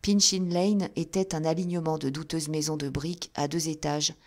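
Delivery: ordinary delivery, nothing marked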